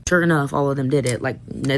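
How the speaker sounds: ordinary talk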